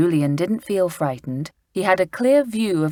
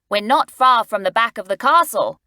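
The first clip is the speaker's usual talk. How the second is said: put-on a boy's eager voice, slightly raised; Julian voiced lightly; 5 semitones higher, 6 dB louder than usual. A narrator's reading. Julian pointing out the castle, eagerly. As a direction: read Julian's line as the eager boy.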